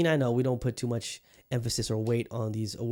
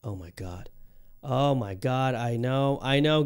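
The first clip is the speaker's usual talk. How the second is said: usual speaking voice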